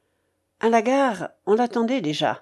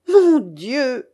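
loud, raised exclamation; dismayed cry; 4 semitones higher, 6 dB louder than usual. A narrator's reading. Babouchka sees her lost stake, crying out. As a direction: cry out at the bad luck. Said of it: "cria-t-elle".

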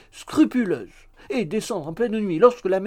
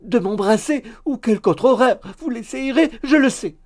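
loud, high peevish accusation; annoyed; 9 semitones higher, 9 dB louder than usual. A narrator's reading. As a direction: accuse him in theatrical indignation. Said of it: "dit Miggs d'un air agacé". (accusation agacée, forte et aiguë)

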